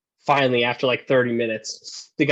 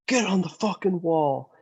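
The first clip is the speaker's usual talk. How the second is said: mock yell; 7 semitones higher than usual. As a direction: imitate a superior yelling a command. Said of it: "one of them yells".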